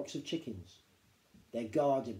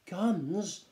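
higher startled "Guns?"; Danny startled at hearing of guns, higher; alarmed question; 6 semitones higher, 3 dB louder than usual. A narrator's reading.

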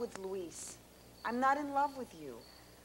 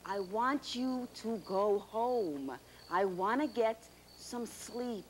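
measured and lower, word by word; slow, deliberate plea; 5 semitones lower, slower than usual. Acted. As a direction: spell it out slowly for a stubborn listener. Maria spells out her request.

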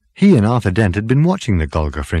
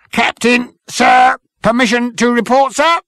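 high, loud parade-ground reporting; zealous military subordinate; 5 dB louder than usual. Acted. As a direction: report to the captain like an eager officer. Number Two reporting to captain.

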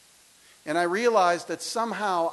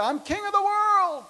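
much higher, loud triumphant cry; DiCaprio shout; 6 dB louder than usual. Retold or imitated. Boy leaping off the fence, a triumphant high shout. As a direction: fling it out like Titanic's bow scene. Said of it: "like Leonardo DiCaprio".

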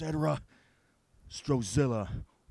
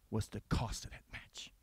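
bitter whisper; 4 semitones lower, 7 dB quieter than usual. Acted. Promo whisper.

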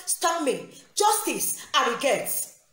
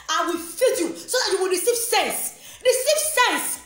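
high-pitched rebuke building in force; angry scolding; 5 semitones higher than usual. Real, not acted. Scolding Daddy Freeze into sense, voice rising angrily. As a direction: climb higher and harder to a sharp peak. Scolding opponent, climbing in anger.

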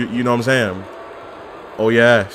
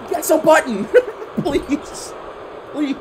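high laughing plea; 14 semitones higher, 3 dB quieter than usual. Real, not acted.

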